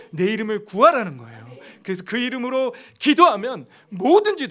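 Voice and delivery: 힘차게 외치는 설교; full-force preaching. Acted. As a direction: preach it out, driving hard to the congregation.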